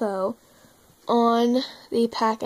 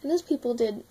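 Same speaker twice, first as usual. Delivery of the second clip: normal talking voice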